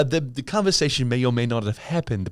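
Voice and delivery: ordinary delivery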